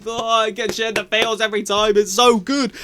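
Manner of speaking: high, excited gush. Real, not acted. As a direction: swoon over the scene. Gushing over the reunion scene.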